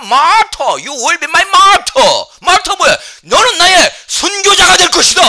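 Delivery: high, full-force preaching shout; impassioned proclamation. Shown as a demonstration. Preacher reveals that witness really means martyr, high booming shout. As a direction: hammer out each phrase at the top of the voice.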